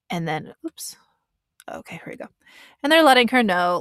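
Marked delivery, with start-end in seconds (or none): 0.1-2.3 whispered aside. Real, not acted. Fumbling aside while cueing video.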